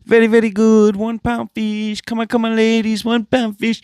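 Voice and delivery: mock street-vendor sales chant; imitating the one pound fish seller. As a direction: hawk fish to passing ladies like the one pound fish guy. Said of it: "He's like".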